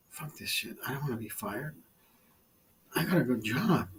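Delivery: hushed, breathy muttered self-talk; whispered to himself. Retold or imitated. Man muttering to himself before shaving for work, whispered. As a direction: say it under your breath, almost silent.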